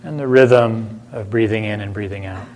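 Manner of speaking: unhurried meditation voice with long silences between phrases; calm, spacious delivery, leaving room to breathe; guided meditation. Acted. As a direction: gently guide listeners inward, phrase by phrase.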